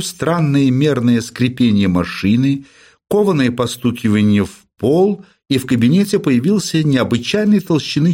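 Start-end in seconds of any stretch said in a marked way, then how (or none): none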